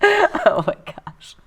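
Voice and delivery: high squeal through laughter; slow and stretched, cracking up; laughing. Real, not acted. Cracking up at the joke.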